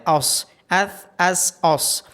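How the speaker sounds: slow ث, س then heavy ص; slow letter drill. Shown as a demonstration. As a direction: model three s-sounds slowly. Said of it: "صاد کو موٹا پڑھا جاتا ہے".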